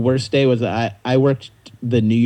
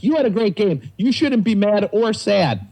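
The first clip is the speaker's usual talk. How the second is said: consoling fans; 9 semitones higher than usual. Retold or imitated.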